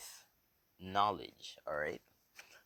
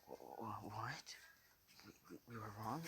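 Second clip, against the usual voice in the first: very soft, stuttering disbelief; acted hushed shock; stunned stammer; 14 dB quieter than usual. Listeners shocked by the revelation.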